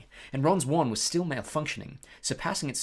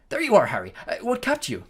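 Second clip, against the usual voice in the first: brisk; 8 semitones higher, 7 dB louder than usual. Acted. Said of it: "said Wood briskly".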